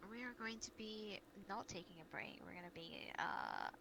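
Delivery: ordinary talk, nothing marked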